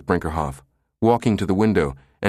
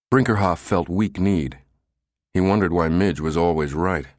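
plain reading voice

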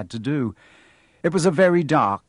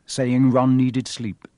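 usual speaking voice

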